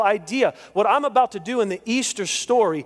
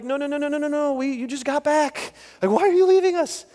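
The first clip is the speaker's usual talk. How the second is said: pleading disciples; 8 semitones higher than usual. Retold or imitated. Disciples begging Jesus not to leave, high and rising. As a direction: beg him not to go, voice climbing in disbelief. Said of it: "You're like".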